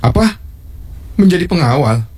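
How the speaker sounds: sharp startled "what?"; surprised. Acted. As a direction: caught off guard, high and sudden. King shocked by request.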